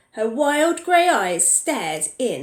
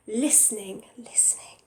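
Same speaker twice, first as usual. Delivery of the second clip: breathy whispered repeat, fading away; 7 dB quieter than usual. A narrator's reading. Narrator whispering as the thief listens. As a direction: hush it as if sneaking.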